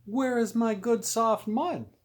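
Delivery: high, loud little pig; small pig voice. A narrator's reading. Small pig asking the farmer's wife.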